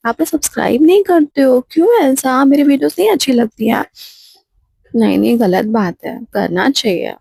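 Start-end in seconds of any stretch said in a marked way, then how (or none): 0.1-3.9 higher, louder playful complaint to viewers; pouting mock hurt; mock sulk. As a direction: tease them for not subscribing.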